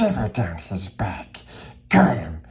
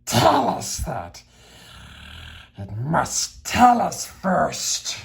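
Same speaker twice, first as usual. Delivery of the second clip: higher, slow, hissed creature demand; Gollum hissing; 5 semitones higher, slower than usual. Acted. Gollum demands what is in Bilbo's pockets, hissing slowly. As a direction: hiss the demand with suspicion. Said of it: "came a sharp hiss".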